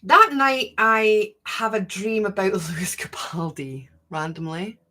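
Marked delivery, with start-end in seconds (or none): 2.5-4.8 whispering. Real, not acted. Whispered confession with laugh.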